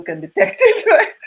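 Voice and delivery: laughing high as she says it. Real, not acted. Laughing to a friend about hidden accents. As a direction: break into laughter mid-line.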